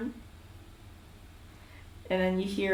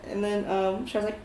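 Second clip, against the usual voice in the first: usual speaking voice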